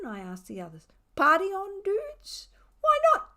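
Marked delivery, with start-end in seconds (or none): 1.2-2.5 squeaky high cat asking the gang. Acted. Cat egging friends on, squeaky high voice. 2.8-3.3 very high, loud cat voice; Tiger's voice. Acted. Tiger the cat answering his friend, high and loud. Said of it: "said Tiger".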